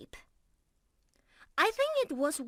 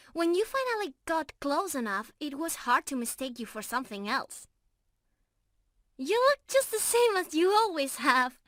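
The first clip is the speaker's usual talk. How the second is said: ordinary delivery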